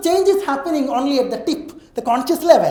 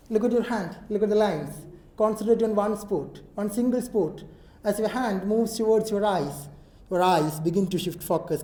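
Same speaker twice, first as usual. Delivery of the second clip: soft, slow hypnotist voice; hypnotic induction; 3 semitones lower, 8 dB quieter than usual. Acted. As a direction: lull them into focus, slowly. (പതിഞ്ഞ hypnotic സ്വരം)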